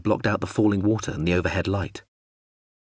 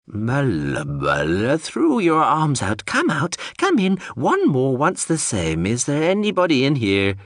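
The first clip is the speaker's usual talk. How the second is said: slow, strange, singsong machine babble; a confused robot drone rambling; babbling drone voice; 6 semitones higher than usual. Acted.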